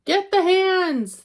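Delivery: loud, slow, high urgent shout. Acted. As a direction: bellow it for the farmhands. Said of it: "the farmer shouted".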